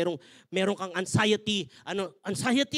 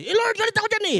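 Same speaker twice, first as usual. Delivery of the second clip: higher and louder, petulant protest; protesting husband; 10 semitones higher, 6 dB louder than usual. Retold or imitated. Husband protesting to God, high and petulant. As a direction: protest like a sulking child.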